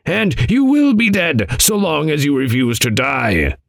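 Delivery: high, insistent rebuke; reproving elder figure. Acted. Voices Adam's riddling answer.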